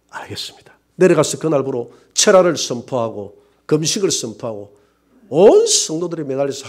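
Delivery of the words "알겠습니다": soft whispered obedience; whispered yes. Retold or imitated. Pastor obeying God's command, whispered. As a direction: barely breathe it.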